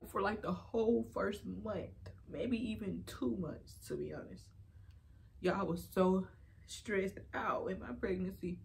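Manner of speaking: soft, halting, holding back real tears; really emotional. Real, not acted. Vlogger recalling early pregnancy stress, emotional and trying not to cry. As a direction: push on softly through rising emotion. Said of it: "I'm getting emotional".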